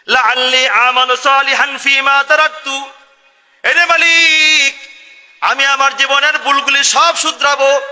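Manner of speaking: high, loud, slow begging chant; loud pleading cry. Acted. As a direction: wail the promise to mend your ways. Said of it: "তখন সে সঙ্গে সঙ্গে বলে".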